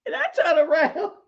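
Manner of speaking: normal talking voice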